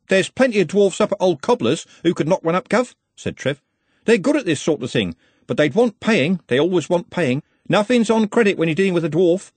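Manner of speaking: lightly voiced street lad, matter-of-fact. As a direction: explain the trade to the boss. Voices Trev in story.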